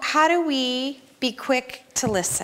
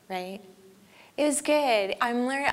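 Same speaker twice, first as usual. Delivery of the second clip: ordinary delivery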